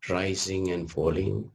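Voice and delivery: ordinary delivery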